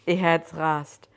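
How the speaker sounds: ordinary delivery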